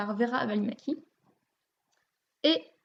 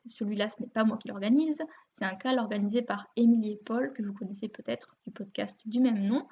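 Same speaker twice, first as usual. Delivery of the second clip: usual speaking voice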